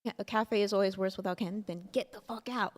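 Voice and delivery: ordinary delivery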